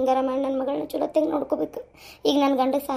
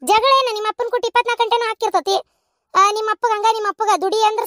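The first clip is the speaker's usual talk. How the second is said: annoyed; 6 semitones higher than usual. Acted. Mother snaps at daughter on phone.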